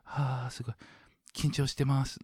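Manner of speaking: 緊張した声で; nervous self. Retold or imitated. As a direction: act flustered and shy. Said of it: "I was like".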